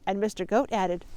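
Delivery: usual speaking voice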